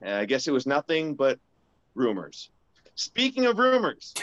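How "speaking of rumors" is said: high, slow hammed-up announcer segue; milk the segue like a showman. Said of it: "Nailed the transition".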